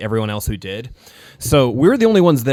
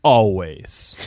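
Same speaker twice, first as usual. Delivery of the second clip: smug low "Always"; 5 semitones lower than usual. Retold or imitated. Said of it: "and he says".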